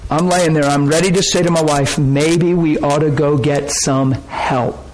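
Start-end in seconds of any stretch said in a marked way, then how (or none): 2.0-4.9 very slow, hesitant; slowly, giving up; slow hesitant plea. Retold or imitated. Ready to say to wife.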